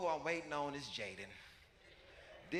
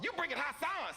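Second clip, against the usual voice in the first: high, louder shocked question; alarmed disbelief; 4 dB louder than usual. Retold or imitated. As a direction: imitate a cousin appalled by the news. Cousin alarmed at the news.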